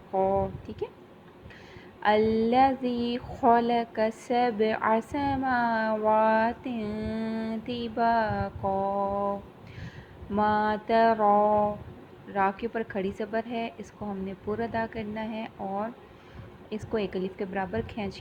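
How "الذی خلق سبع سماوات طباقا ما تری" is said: slow, drawn-out tajweed chant; Quranic recitation. Shown as a demonstration. Teacher reciting for the Quran class, slow and drawn out. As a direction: recite it slowly, stretching each vowel.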